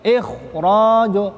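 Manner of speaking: ikhraju slow, the kh and r heavy and full-mouthed; thick tafkhim reading. Shown as a demonstration. Teacher models the correct heavy ikhraju for students, slow and thick. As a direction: draw out ikhraju with a thick, dark kh and r. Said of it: "tebal dia dibacanya".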